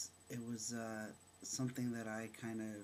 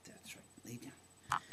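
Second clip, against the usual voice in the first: soft hushed whisper; 3 dB quieter, slower than usual. Real, not acted. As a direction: breathe the words quietly. Quiet opening aside, unvoiced.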